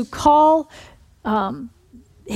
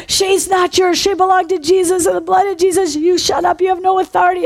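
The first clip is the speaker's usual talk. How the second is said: higher, forceful acted command; commanding rebuke; 7 semitones higher, 3 dB louder than usual. Minister orders the devil out of Irene, forceful high rebuke. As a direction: drive the devil back with authority.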